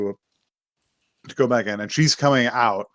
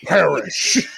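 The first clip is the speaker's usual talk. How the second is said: mock shout with laughter breaking in; hammed-up condemning dad; 5 semitones higher than usual. Dad condemning his son, hammed-up and laughing.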